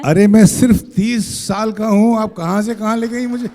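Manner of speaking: high, loud playful protest; mock outrage. Real, not acted. Guest protesting to the interviewer about his age, mock shocked.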